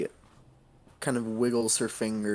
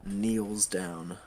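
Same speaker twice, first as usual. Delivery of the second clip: ordinary talk, nothing marked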